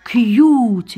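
teacher modelling the word cute; English word cute. Shown as a demonstration. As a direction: say كيوت with a long yoo. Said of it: "نقولوا".

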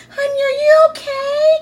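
very high, slow caring voice; sweet concerned lady. Retold or imitated. Stranger knocking on the car window asking kindly, very high and slow. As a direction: ask gently like a worried stranger.

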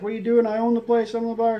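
high-pitched, outraged protest; indignant owner. Retold or imitated. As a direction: squeak it out like a boss nobody believes. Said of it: "and he was just like".

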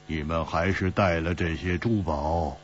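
ordinary delivery

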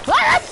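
sudden high shriek of fright; loud high startled yelp; startled scream. Real, not acted. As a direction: scream in real shock. Jump scare in horror game.

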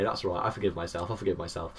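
consoling himself under his breath; quiet self-talk. Real, not acted. Consoling himself after a mistake.

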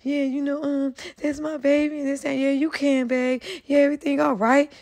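soft, mocking imitation of TJ playing it cool. Mocking how TJ lets Jag talk down to him, soft mimicry. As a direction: lightly mimic a meek boyfriend, quietly. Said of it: "just letting this shit ride like this".

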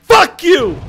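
sudden high, loud shout; explosive swearing. Pretend. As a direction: burst out at the enemy. Sudden outburst after calm disclaimer.

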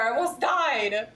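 normal talking voice

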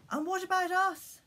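shouted. A narrator's reading. Religious people calling out to John, high shout. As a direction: squeak it out from the back of the crowd. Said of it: "shouted some very religious people".

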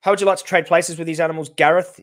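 sharp accusing challenge; angry challenge. Real, not acted. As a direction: fire the question straight at Gareth.

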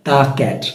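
দা ক্যাট with an open "daa"; "thuh" before consonant. Shown as a demonstration. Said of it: "vowel এবং কনসোনেন্টের নিয়ম".

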